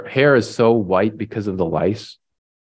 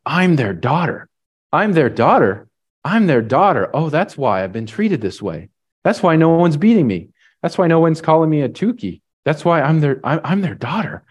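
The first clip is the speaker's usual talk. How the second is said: higher, growing wonder and joy; joyful realization; 6 semitones higher than usual. Retold or imitated. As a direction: let the discovery spill out. Girl realizing while running home.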